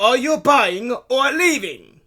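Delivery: high, slow, loud bellow. A narrator's reading. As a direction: bellow impatiently at a dawdling customer. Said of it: "he bellowed".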